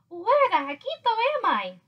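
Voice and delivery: high, puzzled exclamation; lost little hero puzzling out loud; wondering aloud. A narrator's reading. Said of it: "wondered Skipito aloud".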